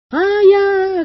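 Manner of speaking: high, drawn-out calling cry; faint far-off holler, held on one note; distant cry. A narrator's reading. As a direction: call out high and long across the water. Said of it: "кричал кто-то на берегу".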